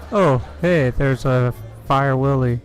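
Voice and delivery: normal talking voice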